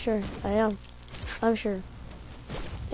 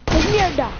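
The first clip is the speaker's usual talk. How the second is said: sharp curse; 5 semitones higher, 6 dB louder than usual. Real, not acted. Reacts to danger in game.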